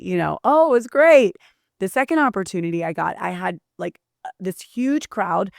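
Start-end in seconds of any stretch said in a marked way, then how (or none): 0.4-1.4 mock cheerful. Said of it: "I wish I could say".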